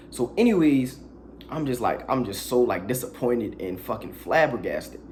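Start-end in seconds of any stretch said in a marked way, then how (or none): none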